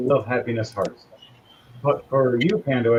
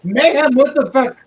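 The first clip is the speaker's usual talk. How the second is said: higher, louder, fed up; exasperated outburst; 9 semitones higher, 7 dB louder than usual. Acted. Wife yelling at Mayhem in disbelief. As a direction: snap it in disbelief.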